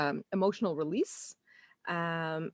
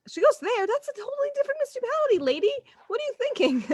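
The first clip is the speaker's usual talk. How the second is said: much higher mock-indignant official voice; mocking council lady; faster than usual. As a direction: scoff at the mother as a scolding clerk. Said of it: "You're like".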